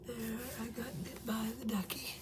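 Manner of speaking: soft unvoiced whisper to himself. Real, not acted. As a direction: whisper it under your breath. Whispering while fixing the card.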